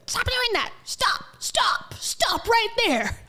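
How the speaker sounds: high, strained mock yell without volume; mock barking. Reactor stopping people misusing quantum, high mock barking yell. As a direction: yelp it out like a dog barking. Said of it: "bark like a dog just because I'm like".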